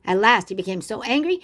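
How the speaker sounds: ordinary delivery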